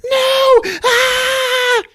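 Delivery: high, loud, hammed-up shriek; mock scream. Acting out a movie victim, high mock scream.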